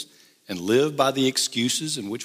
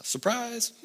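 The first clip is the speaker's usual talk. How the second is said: mock surprise; 10 semitones higher, 6 dB quieter than usual. Joking about anniversary trip.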